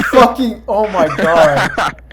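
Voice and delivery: slow, laughing oh my God; cracking up while speaking. Real, not acted. Cracking up mid story.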